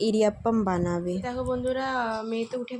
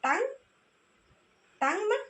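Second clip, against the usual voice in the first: excited coaxing; 10 semitones higher than usual. Real, not acted. Quizzing toddler on body parts.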